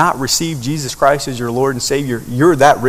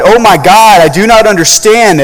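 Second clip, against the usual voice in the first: higher and louder, pleading to God; anguished cry; 8 semitones higher, 8 dB louder than usual. Acted. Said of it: "and I cried".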